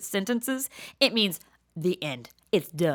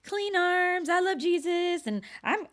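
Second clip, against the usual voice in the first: higher, prim mock voice of her past self; 9 semitones higher than usual. Speaker quoting her old self, a mildly mocking prim voice. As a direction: lightly play your old churchy self. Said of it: "I was like".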